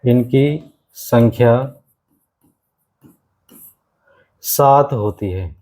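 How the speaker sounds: slow, deliberate, word by word; teacher dictating the count slowly; slow dictation. Shown as a demonstration. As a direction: say it slowly for the notebook. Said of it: "इनकी संख्या एक बार जान लो".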